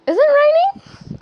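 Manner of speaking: Is it raining? called out high, loud and slow; high surprised call. Real, not acted. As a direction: roep het hoog en luid over de kamer.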